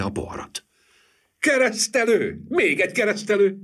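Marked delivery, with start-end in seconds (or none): 1.4-3.6 high, loud cheering; joyful cheer. A narrator's reading. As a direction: cheer it out gleefully. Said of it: "ujjongtak vidáman szerte".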